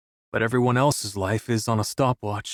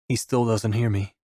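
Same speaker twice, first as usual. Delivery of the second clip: ordinary delivery, nothing marked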